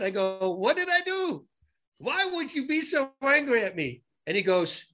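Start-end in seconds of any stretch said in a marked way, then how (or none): 0.5-4.0 high, pleading questions, stretched out; exaggerated puzzled protest; mock bewilderment. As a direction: act out baffled innocence, pitch way up. Retold plea to angry driver.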